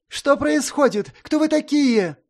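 high, loud indignant protest; outraged young noble demanding answers. A narrator's reading. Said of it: "возмутился хлыщ".

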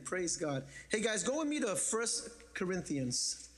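ordinary delivery

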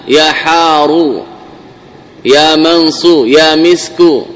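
slow يا حارو, يا منصو, يا مسكو, each ending in a stressed u; drawn-out vocatives closing on a clear damma; teacher sounding out the u ending slowly; truncated vocatives. Shown as a demonstration. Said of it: "كلها يبنيها على، على الضم".

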